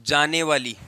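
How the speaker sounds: slow word-by-word dictation, long pauses. Real, not acted. Teacher dictating the definition to students, slow measured dictation. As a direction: dictate it piece by piece for note-taking.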